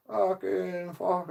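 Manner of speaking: high, very slow mocking swear. Son mocking his mum's swearing, high and slow. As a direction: stretch it out high to mock her. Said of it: "taking piss out of her".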